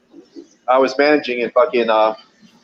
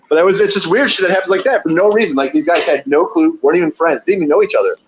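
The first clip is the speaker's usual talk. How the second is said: ordinary talk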